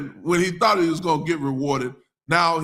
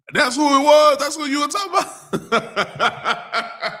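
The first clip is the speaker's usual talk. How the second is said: surprised; 10 semitones higher, 4 dB louder, slower than usual. Real, not acted. Surprised realisation, laughing.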